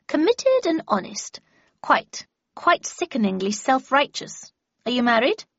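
put-on a cutting, scornful old lady; stern old lady Havisham. A narrator's reading. Havisham voiced in story.

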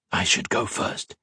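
slow, soft narrated whisper. A narrator's reading. Modo stops Serdar in the hallway, whispered. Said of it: "he whispered".

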